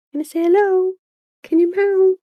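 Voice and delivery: asking the cat to meow; pet talk. Real, not acted. Talking to the cat.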